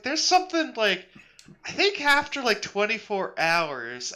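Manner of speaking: high, hesitant puzzled voice; trying to recall something; vague half-remembering. Retold or imitated. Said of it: "Marsh is like".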